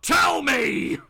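high, loud, forceful demand; yelling. Acted. The vigilante yelling at a stuttering Bob for answers. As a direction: bellow it as an order. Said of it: "Batman yells out".

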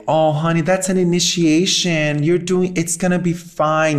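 high-pitched cooing comfort; mock reassurance. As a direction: imitate someone soothing with easy platitudes. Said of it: "or, or to say".

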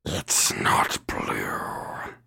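very slow rasping whisper; menacing witch. Acted. Unseen witch appraising strangers, menacing slow whisper. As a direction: hiss it out slowly, all breath and rasp. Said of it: "that is menacing".

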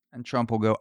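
normal talking voice

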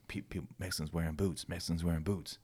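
low, very soft retold murmur; imitating inmates quietly repeating a code; furtive murmur. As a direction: pass the word along under your breath. Inmates passing coded word, retold.

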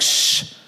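a drawn-out shush. Retold or imitated. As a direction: hush someone with a "shh". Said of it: "shushing him".

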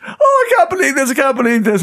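high, loud cry of disbelief, as the retold friend; shocked disbelief. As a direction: wail it like you just failed the test. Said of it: "she was shocked".

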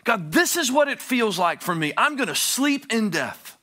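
loud, high pleading; begging. Acted. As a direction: beg God with everything. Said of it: "He's begging God".

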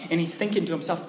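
ordinary delivery

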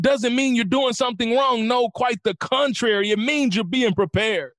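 high, driving rally speech; impassioned motivational preaching. Real, not acted. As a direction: urge on listeners who are in a fight.

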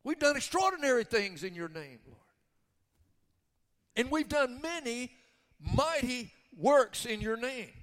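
loud, high-pitched boasting protest; arrogant, belligerent. Acted. The many plead their mighty works to Jesus, arrogant and loud. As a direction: insist on it as a challenge. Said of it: "It's an arrogant, belligerent".

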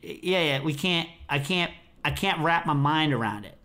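ordinary talk, nothing marked

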